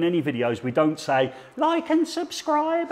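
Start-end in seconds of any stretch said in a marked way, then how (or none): 1.6-2.9 much higher and slow, sing-song "like and subscribe"; mocking YouTuber plea. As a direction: parody the cheesy YouTuber catchphrase.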